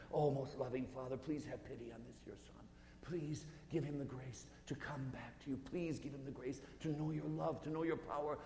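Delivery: a lightly voiced mother, gently pleading; a hint of a mother begging mercy; Mary's pleading prayer. Mary prays for the thief.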